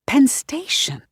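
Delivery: Penn Station whispered, breathy and hushed on Penn; whispered realization. A narrator's reading. Young man realizing he is in Penn Station, whispered. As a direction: breathe the name Penn Station as it dawns on him. He recognizes where he is.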